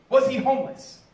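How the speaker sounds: loud, high punchline question; incredulous mock question. Comic quoting the second question police asked him, loud mock outrage. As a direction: blurt the absurd question at full volume.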